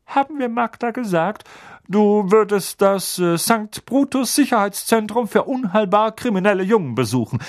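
high, loud put-on uncle voice; scheming uncle. A narrator's reading. Uncle telling Harry the cover story, menacing. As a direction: announce it with menace. Vernon reveals the lie.

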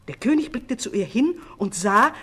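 plain reading voice

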